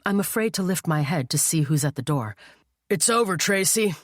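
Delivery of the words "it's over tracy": lightly voiced booming officer; a touch of a commanding male boom. A narrator's reading. Officer confronting Tracy at the door, booming. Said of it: "a male voice booms".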